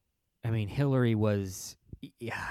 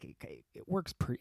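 normal talking voice

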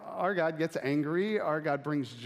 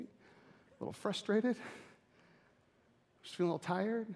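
mock understatement; 6 semitones higher, 4 dB quieter than usual. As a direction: play it down sweetly. Downplaying anger.

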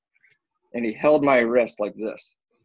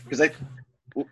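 usual speaking voice

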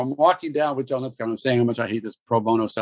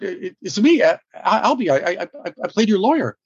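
flustered; 6 semitones higher than usual. Retold or imitated. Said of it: "and I said".

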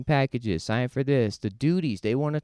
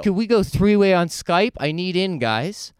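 eager pleading; 7 semitones higher, 4 dB louder than usual. Retold or imitated. Guy begging to join chat.

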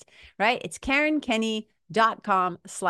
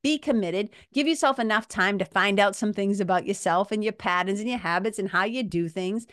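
ordinary delivery

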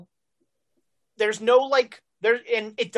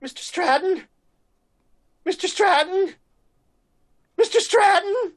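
calls rising higher and louder each time; from worried to frantic, calling out; panicked calling; 10 semitones higher, 5 dB louder than usual. Acted. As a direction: henchman calling for his fallen boss, growing panicked. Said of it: "the third guy is just like".

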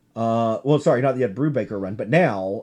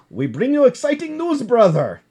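put-on higher, louder Russian accent; mock Russian accent, pitched high; 9 semitones higher, 5 dB louder than usual. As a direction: announce it like a hammy Russian. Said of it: "I'm doing this with a Russian accent".

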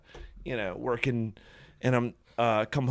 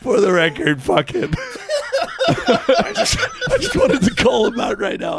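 laughing; 3 dB louder than usual. Real, not acted. Laughing while calling him out.

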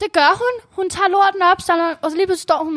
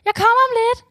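fake calm; 7 semitones higher than usual. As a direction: pretend all is fine, pitched high. [name] answers John falsely calm.

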